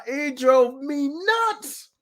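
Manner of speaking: very drawn-out, climbing high; slow, high-pitched frustrated emphasis; exasperated emphasis. Real, not acted. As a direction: stretch each word in exasperation. Worked up over the argument.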